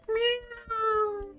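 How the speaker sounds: tiny high meow; squeaky kitten meow; kitten mew. Retold or imitated. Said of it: "he has a mew like a kitten".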